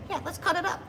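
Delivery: high, squawky laughing bird; parrot voice. Retold or imitated. Imagined parrot answering about DJing, high and laughing. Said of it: "He's like".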